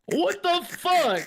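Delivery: high, drawn-out disbelief; stunned disbelief. Real, not acted. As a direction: stretch it out in shock. Stunned reaction to her text.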